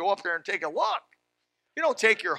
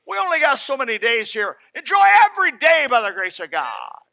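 higher, louder preacher's cry; full-force preaching; 4 semitones higher, 6 dB louder than usual. Acted. Preacher rallying the congregation against the demon of worry, loud and high. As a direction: drive it home from the pulpit.

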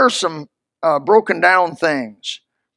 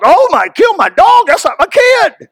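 mock outraged neighbor, much higher and louder; hammed-up shocked neighbor; 12 semitones higher, 6 dB louder than usual. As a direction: squeal like the indignant dog owner. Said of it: "I said, "What, what if I killed your dog?".

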